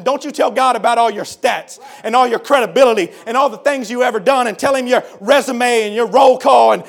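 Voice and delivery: normal talking voice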